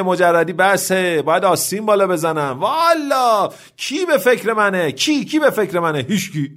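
high-pitched exasperated complaint; worked-up, comically aggrieved rant; fed-up outburst. Acted. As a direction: protest it like you've had enough of being single. Widower's acted outburst about being single.

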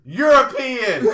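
drawn-out triumphant punchline; punchline shout. Real, not acted. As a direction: stretch the payoff out proudly, laughing. Punchline delivered.

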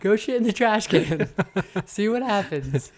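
hammed-up parent daring the kid; mock threatening dare. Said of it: "I'll be like".

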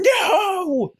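high-pitched, drawn-out mock wail of protest; tearful yelling. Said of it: "Big old tears yelling".

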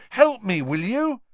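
Harry asking Krum for help; urgent boy. Acted. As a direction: call for help.

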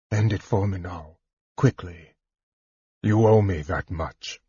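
subdued, even-toned request to be killed; calm plea. A narrator's reading. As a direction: ask it calmly, as a simple favor. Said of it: "Caramon said calmly".

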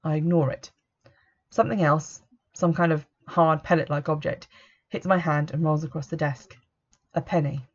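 ordinary delivery, nothing marked

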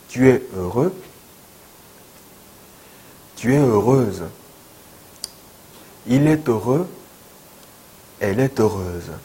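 slow, clear model sentences for students to repeat; repeat-after-me drill. Shown as a demonstration. Teacher leading students in a repetition drill, slow and clear. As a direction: drill each phrase with pauses.

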